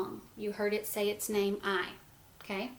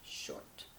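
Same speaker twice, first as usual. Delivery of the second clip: teacher whispering the answer; whispered answer; 13 dB quieter than usual. Shown as a demonstration. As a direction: whisper "short" as a hint. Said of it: "It is".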